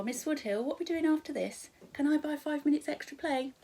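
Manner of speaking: eager pupil. Pretend. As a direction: pipe up like a keen pupil. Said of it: "someone would put their hand up and say".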